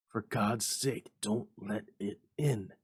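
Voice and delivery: slow, fearful begging; a hint of terror, drawn out; trembling plea. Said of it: "cried the old man, trembling".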